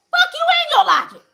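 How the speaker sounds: laughing defiant outburst; defiant shout. Real, not acted. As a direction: fling it back at them.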